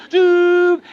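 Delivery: a sharp, loud mock horn blast; trumpet call imitation. Rabbi voices the trumpet call heard in his parable, loud blast. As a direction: blurt it out like a shofar sounding. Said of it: "he hears this trumpet call".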